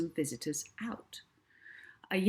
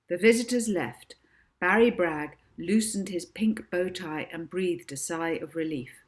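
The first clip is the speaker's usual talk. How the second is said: usual speaking voice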